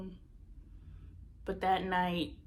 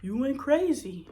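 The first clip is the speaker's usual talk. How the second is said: the guy's answer; 4 semitones higher, 4 dB louder than usual. Retold or imitated. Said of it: "And he's like".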